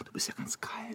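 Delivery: soft unvoiced whisper; hushed and tender, just air. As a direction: breathe it close to her ear. Tender whisper to frightened girl.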